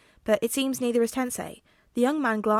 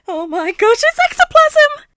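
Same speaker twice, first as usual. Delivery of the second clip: put-on very high, loud, thrilled child squeal; slow, drawn-out squeal of wonder; excited shriek; 15 dB louder than usual. A narrator's reading. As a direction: squeal with delight like a starstruck kid. Excited child spotting a hero.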